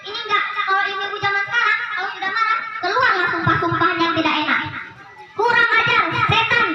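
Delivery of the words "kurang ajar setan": higher, sharp mock scolding; angry mother cursing. Modern mother cursing her child, mock angry. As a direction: snap at the child like an angry mum.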